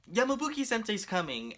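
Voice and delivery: lightly called out, pitched high; imitated call. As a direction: mimic someone calling out news, voice lifted high. Somebody announces the teacher's arrival.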